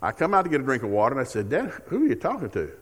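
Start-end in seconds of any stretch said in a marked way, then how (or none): 1.5-2.7 lightly voiced daughter, pitched higher, asking; a hint of a curious daughter catching dad on the phone; daughter's voice. Daughter questions dad at night.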